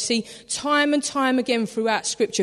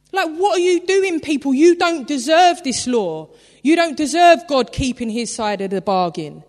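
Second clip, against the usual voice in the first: higher, louder outraged rebuke; angry scolding; 4 semitones higher, 5 dB louder than usual. Retold or imitated. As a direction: tear into them for breaking faith. Moses scolding the people.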